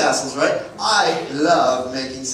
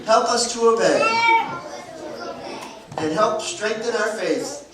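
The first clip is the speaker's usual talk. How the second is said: put-on slow earnest prayer to the children's Jesus; 5 semitones higher than usual. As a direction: lead the little ones in a heartfelt prayer.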